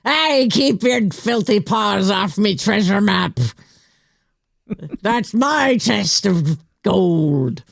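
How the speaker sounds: slow, drawn-out hammy pirate; guard the treasure like a pirate. Pretend. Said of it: "that sounded pretty good".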